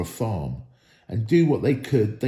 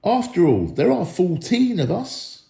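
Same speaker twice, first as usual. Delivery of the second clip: confident retort; 6 semitones higher than usual. A narrator's reading.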